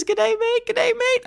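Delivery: high, lightly hammed Australian greeting; mock Australian voice. Shown as a demonstration. Host reading a review, mock Australian accent. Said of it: "Brendan Lee S from Canada says".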